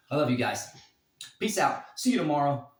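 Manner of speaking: ordinary delivery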